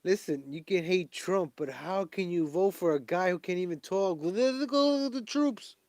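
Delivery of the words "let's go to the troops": put-on high, slow doddering old man voice; mocking old man. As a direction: drag it out like a confused grandpa, pitched high. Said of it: "He's like an old man".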